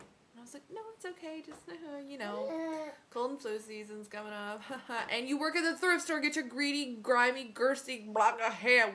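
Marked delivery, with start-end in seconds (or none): 0.7-4.6 high, softened placating voice; retold reassurance, pitched up and quieter; polite brush-off. Retold polite reply excusing herself. 5.1-8.9 loud, higher-pitched exasperated tirade; mock rant. Mock rant at thrift store worker.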